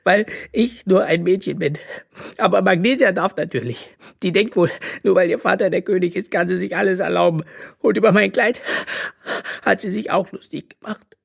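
a hint of tearful sobbing. A narrator's reading. Bö complaining to her mother, lightly sobbing. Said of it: "schluchzte Bö".

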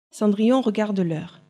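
usual speaking voice

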